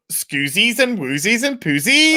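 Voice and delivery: lightly sung-up silly word play. Shown as a demonstration. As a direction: toss off the nonsense words in a goofy lilt. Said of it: "I can't stop doing the".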